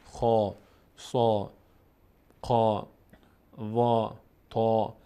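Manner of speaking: "خا، سا، قا، وا، تا" with a full, dark, long aa; full heavy vowel. Shown as a demonstration. Teacher showing the heavy letters, full-mouthed long vowels. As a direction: open upward and round out each long vowel.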